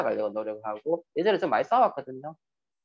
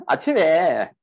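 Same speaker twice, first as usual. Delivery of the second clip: ordinary talk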